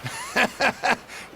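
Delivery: amused laugh. Acted. Laughs at worried question.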